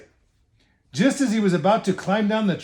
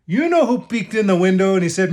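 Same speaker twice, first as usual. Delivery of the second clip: ordinary delivery, nothing marked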